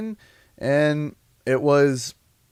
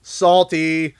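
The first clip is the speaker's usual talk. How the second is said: mock taunt; 3 semitones higher, slower than usual. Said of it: "people that used to be, say".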